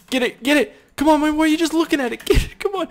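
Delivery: high, loud urging cheers; frantic high-pitched cheering; excited yelling. Real, not acted. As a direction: scream them toward the ball. Cheering a player toward goal.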